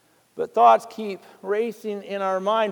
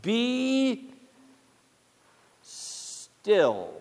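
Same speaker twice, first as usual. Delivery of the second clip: hushed be still; 7 dB quieter than usual. Acted. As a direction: let the words hang in silence. Quoting God's command for reading.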